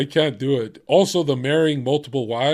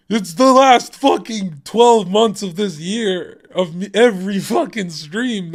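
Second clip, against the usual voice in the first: indignant, raised voice at a chatter; exasperated rant; 5 semitones higher, 5 dB louder than usual. Real, not acted. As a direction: vent at the viewer who missed it all.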